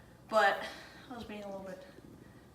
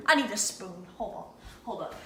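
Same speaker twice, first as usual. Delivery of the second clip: usual speaking voice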